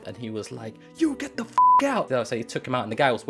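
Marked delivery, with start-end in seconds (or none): 1.0-2.1 loud, high-pitched angry command; shouted order. Retold or imitated. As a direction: yell to throw someone out. Friend throws attacker out, acted.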